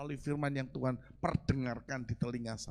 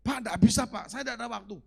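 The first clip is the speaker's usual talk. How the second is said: excuse-making churchgoer; 6 semitones higher, 6 dB louder, faster than usual. Retold or imitated. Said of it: "Baca enggak Anda".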